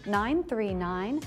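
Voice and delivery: ordinary delivery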